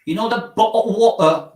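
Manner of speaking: high mock British "bottled water"; mock British accent. Shown as a demonstration. Streamer mocking British slang, high mock British accent. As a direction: lift it high like a Brit. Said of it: "Your lips don't even move".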